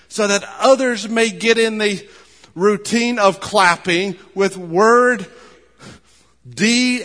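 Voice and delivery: slow, loud, emphatic preacher's cadence; preaching at full force. Acted. Pastor urging the church to clap for Jesus, preaching at full force. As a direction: lean on every phrase like a call to action. Sermon climax on clapping.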